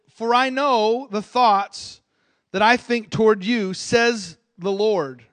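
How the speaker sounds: slow, loud, weighty scripture voice; solemn scripture reading. Acted. Preacher reads Jeremiah to the church, slow and loud. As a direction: proclaim each word slowly. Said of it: "He, he says this".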